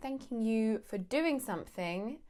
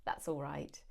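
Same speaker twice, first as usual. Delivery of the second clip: low, soft "That's all right." said without care; grudging; 6 semitones lower, 7 dB quieter than usual. Shown as a demonstration. Thanked for a station pickup, low and grudging. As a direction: mumble it like you didn't want to bother. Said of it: "My tone of voice is telling you everything".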